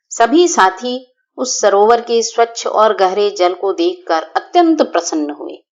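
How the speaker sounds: usual speaking voice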